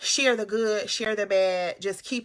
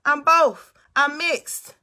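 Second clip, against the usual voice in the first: ordinary talk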